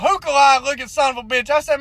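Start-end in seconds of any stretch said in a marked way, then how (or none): none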